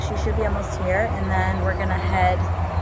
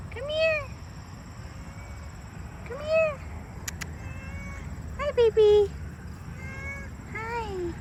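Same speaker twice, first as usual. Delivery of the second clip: cooing to a cat; 8 dB quieter, slower than usual. Real, not acted. Calling her cat Thomasina over, high soft cooing. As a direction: coax the kitty over in a tiny sweet voice.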